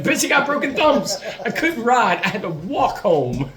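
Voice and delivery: high, laughing exasperated comeback; laughing retort. Retold or imitated. Answering friend who asked what took so long, laughing. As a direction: protest while cracking up.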